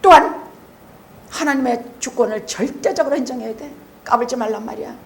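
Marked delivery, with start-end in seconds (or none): none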